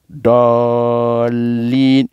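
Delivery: "dolin" with the "do" held long and steady; sustained, level "do" in "dolin"; well drawn-out do. Shown as a demonstration. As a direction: lean on "dolin" and stretch both vowels. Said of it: "le do est bien tiré".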